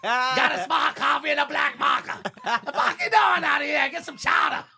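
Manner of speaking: hammed-up, shouted Boston accent, pitched high; loud, exaggerated Boston yelling. Shown as a demonstration. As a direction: holler like a cartoon Bostonian.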